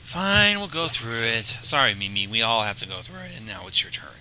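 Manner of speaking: sighing. Acted. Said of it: "Sora sighed".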